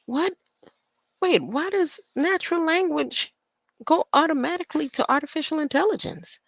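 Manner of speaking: high, loud disbelieving question; baffled, pitch jumps way up; surprised. Real, not acted. Reading about language and AI, surprised and high.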